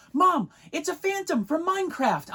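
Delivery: put-on high, loud eager kid voice; very high child's shout; excited little boy. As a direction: tell mom your big discovery. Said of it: "he goes".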